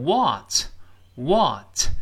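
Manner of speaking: American "what" with a wide-open "ah" vowel; very slow, open American "what"; American pronunciation. Shown as a demonstration. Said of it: "美音中口型大一些".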